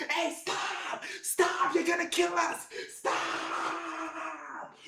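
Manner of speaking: strained yell at reduced volume; imitated desperate shouting; panicked mock screaming. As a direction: beg them to stop, stretching the final word into a long wail. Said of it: "when I was telling them to stop".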